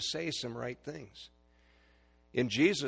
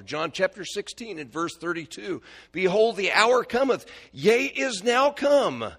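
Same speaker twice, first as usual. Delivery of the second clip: preaching at full force; 7 semitones higher, 12 dB louder than usual. Acted. Preacher to congregation, loud fervent proclaiming. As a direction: proclaim it to the back pews.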